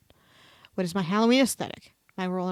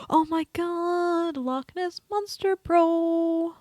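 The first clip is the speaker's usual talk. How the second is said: squealing excitement; 9 semitones higher, much slower than usual. Real, not acted. Gleeful reaction to quiz result.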